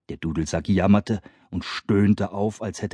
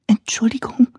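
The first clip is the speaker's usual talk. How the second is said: high timid whisper; peeping whisper. Acted. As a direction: squeak it out shyly. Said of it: "piepste Lina".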